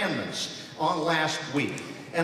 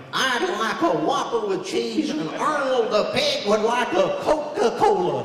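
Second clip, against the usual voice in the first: slightly higher character voice at a drive-through; Mister Haney voice; 5 semitones higher than usual. Retold or imitated. Ordering a Whopper at the drive-through in a character voice. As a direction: order like a TV huckster. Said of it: "do Mister Haney or something".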